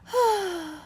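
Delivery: long high contented sigh. A narrator's reading. Snickers, content after the rescue, sighs happily. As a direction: sigh out in satisfaction. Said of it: "lets out a contented sigh".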